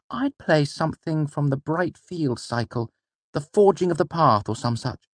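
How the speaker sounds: put-on a keen young boy suggesting. A narrator's reading. Boy's voice held.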